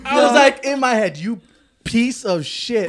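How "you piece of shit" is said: slow, loud insult broken by laughter; laughing insult. Retold or imitated. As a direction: laugh through the insult, higher and louder. Retold inner insult, laughing.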